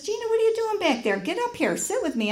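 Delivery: high, quick, urging voice; acted insistent nagging; exasperated friend. Friend scolds her for hanging back.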